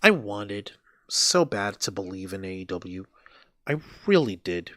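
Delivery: dejected lament. Real, not acted. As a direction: say it like giving up hope. Lamenting lost faith in AEW.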